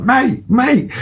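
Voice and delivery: very high calling-out voice; high, hooted call for attention; imitated shouting. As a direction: mimic hailing a passing runner. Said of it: "I started shouting to him".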